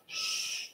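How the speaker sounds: quick playful shh; imitated shush. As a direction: hush someone while you listen. Shaman hushes her with headphones.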